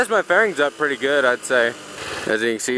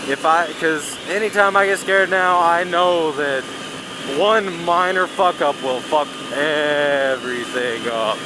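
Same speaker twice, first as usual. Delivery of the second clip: ordinary talk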